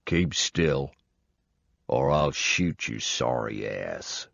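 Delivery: southern threat, unhurried and cold; cool southern drawl. Acted. Armed old man warning the intruder, slow southern drawl. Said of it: "he said in a really cool, calm, deep southern drawl".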